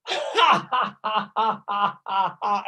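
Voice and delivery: high open laughter bursts; yoga laughter. Shown as a demonstration. Teacher laughs through the exercise for the class, free laughter. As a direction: laugh freely on the twist. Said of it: "do it the other way".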